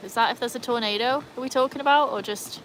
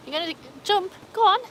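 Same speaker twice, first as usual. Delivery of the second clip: coaxing pet voice; 11 semitones higher, 4 dB louder than usual. Real, not acted. Coaxing dog into pool.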